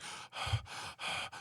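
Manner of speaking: soft, voiceless frightened puffs; imitated panting. Bartender coming back after robbery, mimed shaken panting. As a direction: act out shaken breaths, one after another.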